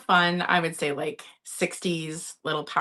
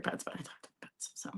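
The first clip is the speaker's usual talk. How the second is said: whisper; 17 dB quieter, faster than usual. Real, not acted. Confiding aside to viewers.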